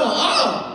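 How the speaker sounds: high, hammed-up scream of panic; loud comic wail; panicked cry. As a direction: freak out in one high yell. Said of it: "I'm freaking out".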